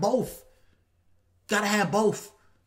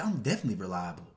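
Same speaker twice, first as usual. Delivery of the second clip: proper interview voice; 12 semitones lower, 8 dB quieter, faster than usual. Retold or imitated. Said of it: "But if you".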